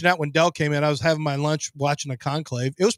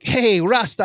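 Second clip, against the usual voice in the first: put-on Jamaican street accent, a bit louder; Jamaican slang voice; 5 semitones higher, 4 dB louder, much slower than usual. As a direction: greet him in mock Rasta slang. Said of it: "I'm learning a lot of new things, by the way, from Top Boy".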